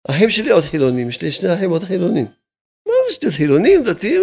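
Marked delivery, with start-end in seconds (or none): 2.9-3.3 high mocking voice. Rabbi scoffing at those arguing over two hundred shekels, high and mocking. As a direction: jump way up into a high, incredulous mocking pitch.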